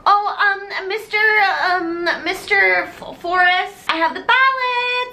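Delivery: high, hesitant sing-song; mock innocent schoolgirl; sing-song innocence. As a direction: play dumb sweetly. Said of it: "And Norma goes".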